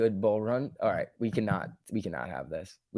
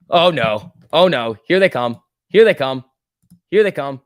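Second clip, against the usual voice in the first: louder, hammed-up alarm; a playful loud panic; mock dread; 2 semitones higher, 10 dB louder than usual. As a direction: announce the trolls arriving. Mock dread at incoming trolls.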